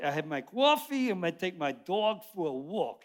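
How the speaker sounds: ordinary talk, nothing marked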